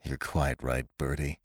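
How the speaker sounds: low, soft groan of defeat; despondent, muted admission. A narrator's reading. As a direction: groan it out, voice sunk right down. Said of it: "He groaned".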